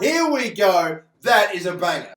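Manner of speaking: loud, thrilled shout over a great card; excited shout. Real, not acted. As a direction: cheer it out. Pack opening reveals star card.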